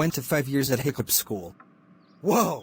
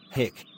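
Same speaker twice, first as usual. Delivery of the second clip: hiccup; 3 dB quieter than usual. Acted. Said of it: "stop doing that".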